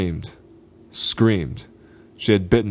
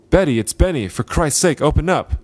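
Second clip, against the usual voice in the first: an urgent raised plea; urgent call; 7 semitones higher than usual. Acted. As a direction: call out to get the door opened. Pleading through hotel door.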